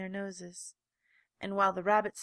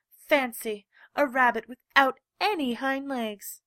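loud, higher mocking cry; 4 semitones higher, 9 dB louder than usual. A narrator's reading. Wild rabbit mocking the legless rabbit, loud. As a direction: jeer at the rabbit. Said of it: "he began to laugh".

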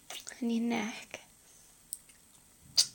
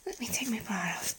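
soft intimate whisper; breathy close-mic whisper; 4 dB quieter than usual. Acted. Whispered intimate roleplay throughout.

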